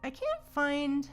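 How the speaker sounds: ordinary talk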